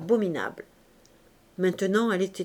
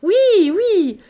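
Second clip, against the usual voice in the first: high, very loud rowdy shout; shouting crowd; 9 semitones higher, 9 dB louder, slower than usual. A narrator's reading. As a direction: yell it with the whole drunken table. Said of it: "criait toute la table".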